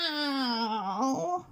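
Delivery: high, drawn-out falling cat wail; cat yowl. A narrator's reading. Scarface Claw in the tree, a long high yowl. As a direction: wail it like a cornered tomcat. The cat's yowl echoes around.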